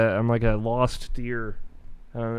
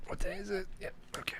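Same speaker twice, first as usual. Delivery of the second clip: whispering; 10 semitones higher, 17 dB quieter, faster than usual. Real, not acted. Muttered aside before intro.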